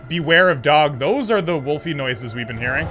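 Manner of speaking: usual speaking voice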